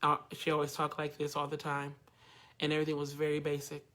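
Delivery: low, quiet deadpan monotone. Retold or imitated. Coach imitates the show Daria to explain pitch, deadpan monotone. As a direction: imitate a bored cartoon teen on one low note. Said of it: "So that monotone".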